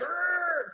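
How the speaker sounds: mock snarling growl; angry growl. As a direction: growl like a cornered animal.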